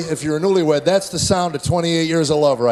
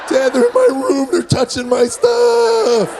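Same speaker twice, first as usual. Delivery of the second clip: much higher, louder, slow screaming; teen son screaming at his brother; shrieking teen; 5 dB louder, slower than usual. Retold or imitated. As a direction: scream like a kid whose voice hasn't broken. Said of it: "Standing in the hall screaming".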